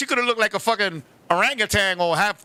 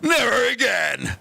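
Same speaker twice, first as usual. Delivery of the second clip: low, slow, shouted stern dismissal; deep, drawn-out mock father's command; 10 semitones lower, slower than usual. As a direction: throw him out like a stern old kung fu film father.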